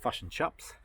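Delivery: fish and chips as "fush and chups"; Kiwi pronunciation. Shown as a demonstration. Answering how fish and chips is said, Kiwi "fush and chups". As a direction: centralise both vowels: "fush and chups". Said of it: "how do they say fish and chips".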